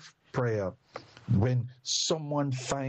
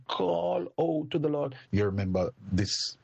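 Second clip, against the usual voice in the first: ordinary talk, nothing marked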